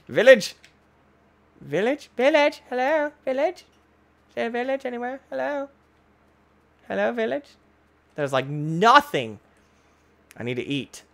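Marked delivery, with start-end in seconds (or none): 0.1-7.5 high-pitched searching call. Pretend. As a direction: call hopefully in a much higher voice. Calling out for a village.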